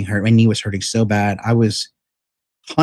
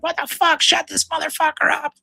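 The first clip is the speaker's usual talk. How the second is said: put-on high, far-off squawk of angry old ladies; imitating angry old Korean women yelling, much higher; mock screaming old women; 3 dB quieter than usual. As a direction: shriek it like the furious old women down the hall. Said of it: "old Korean women were, like, screaming".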